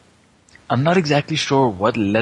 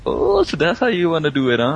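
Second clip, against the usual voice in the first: knowing tease; 6 semitones higher than usual. Acted. Mini sidekick hears of girls' dorm plan, teasing. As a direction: tease him as if catching on.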